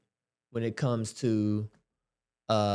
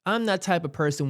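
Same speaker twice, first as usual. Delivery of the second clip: ordinary talk, nothing marked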